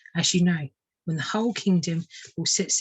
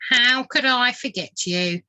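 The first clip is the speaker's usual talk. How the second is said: slow, pointed retort; sharp; 6 semitones higher, 4 dB louder, slower than usual. Acted. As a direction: needle the clumsy bird. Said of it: "said the vole sharply".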